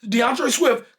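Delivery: ordinary talk, nothing marked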